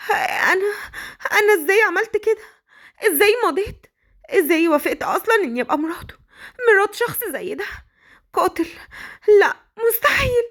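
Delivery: very high, despairing, near tears; shocked girl. Acted. Bride alone after signing, despairing and tearful. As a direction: break down over what she did.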